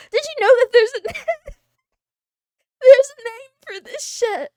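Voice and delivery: high, loud laughing disbelief. Real, not acted.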